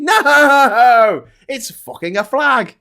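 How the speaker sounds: high, loud, drawn-out mock outrage; exasperated shout. Streamer ranting at flag-obsessed Tory priorities, exasperated shout. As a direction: blurt the answer in loud disbelief. Answers own sarcastic list of priorities.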